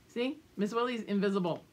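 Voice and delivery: ordinary delivery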